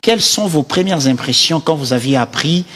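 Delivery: ordinary talk, nothing marked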